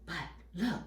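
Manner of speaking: plain reading voice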